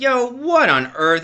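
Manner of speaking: high, slow stunned disbelief; retold shock. Son hearing he failed, stunned and slow. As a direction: drag it out in disbelief.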